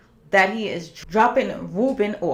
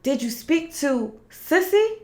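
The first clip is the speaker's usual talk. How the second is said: Leon's voice, pitched higher; lightly voiced Leon, worried about mama; Leon asking; 8 semitones higher than usual. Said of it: "And he's like".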